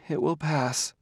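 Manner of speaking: soft, high, hushed voice; whispered. A narrator's reading. Weeping Gladia brushes off Bailey's concern, whispered. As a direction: say it small and hushed. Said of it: "she whispered".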